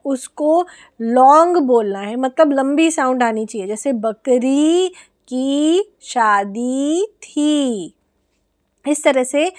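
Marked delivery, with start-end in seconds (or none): 3.7-7.9 बकरी and शादी with the ई stretched long, very slow; long ee sounds. Shown as a demonstration. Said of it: "उसको लंबा करके बोलना है".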